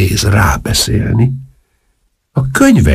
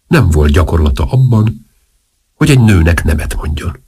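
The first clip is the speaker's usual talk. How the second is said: plain reading voice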